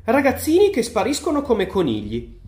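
animated exclamation. A narrator's reading. Wonka to his guests about vanishing children, exclaiming.

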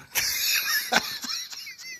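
airy, high, wheezing laughter; breathless laugh. Real, not acted. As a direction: crack up at the punchline. Laughs after the punchline.

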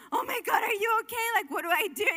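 high startled cry, half-shouted in retelling; alarmed mom. Retold or imitated. Mother hearing her daughter scream, alarmed high cry. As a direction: jolt up in panic at a child's scream. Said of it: "I'm like".

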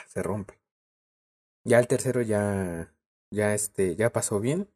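usual speaking voice

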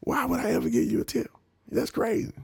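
lightly voiced hustler; mock hustler voice. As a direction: play the stranger up high and amused. Quoting the hustler again.

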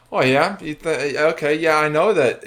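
defiant retort. Acted. Talking back to inner demons.

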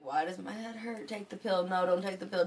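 ordinary delivery

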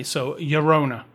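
"Llorona" with a Y sound, slowly; Spanish Llorona. Real, not acted. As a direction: say "Llorona" carefully with a Y. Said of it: "with a bit of an accent".

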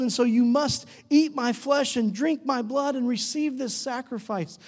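high-pitched, insistent declaration; urgent preaching. Acted. Jesus telling disciples the hard terms, urgent and insistent. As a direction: press each demand home with conviction.

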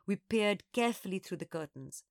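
usual speaking voice

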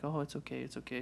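soft, hushed comforting; calm the crying baby softly; soothing a baby. Retold or imitated. Dad patting his crying son's butt, softly soothing. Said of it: "trying to calm him down".

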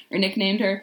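ordinary delivery

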